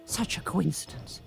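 eerie conspiratorial whisper. Pretend. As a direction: hush it like a secret. Spooky aside whisper.

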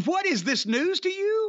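high-pitched, indignant disbelief; incredulous. Real, not acted. Host baffled by co-host's question, incredulous. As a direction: throw the question back, baffled.

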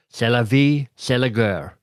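put-on a light French accent on the phrase. Author tossing off a French saying, light French accent.

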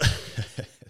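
breathy laugh. Real, not acted. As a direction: burst out laughing. Laughing at own story.

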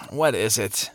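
lightly voiced sleepy man; mumbled a little softly, as if just woken; groggy. Man shaken awake answers a stranger, a touch groggy.

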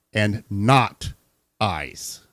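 "not" set apart by pauses; capitalized NOT. Real, not acted. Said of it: "It's capitalized".

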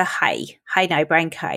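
"how now, brown cow" with Belfast vowels, "hi" for "how"; Belfast accent. Shown as a demonstration. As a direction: turn every ow into a Belfast "aye". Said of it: "That's the way they say it in Belfast".

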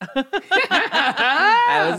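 drawn out through laughter; laughing. Real, not acted. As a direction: laugh while stretching it out.

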